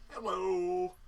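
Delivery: chat voice. Pretend. Reading chat message in voice.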